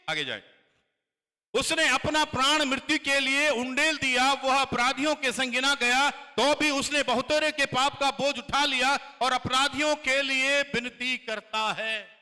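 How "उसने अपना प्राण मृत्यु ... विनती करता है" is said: high, loud, proclaiming reading; loud scripture reading. Acted. Pastor reads Isaiah aloud to congregation, loud and proclaiming. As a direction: declaim the verse to the hall.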